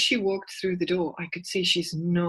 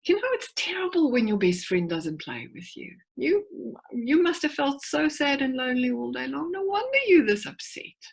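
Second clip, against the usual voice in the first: validating; 6 semitones higher than usual. Retold or imitated. Mum validating her upset daughter, high and tender. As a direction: pour out sympathy, pitched up. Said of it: "I say to her something like".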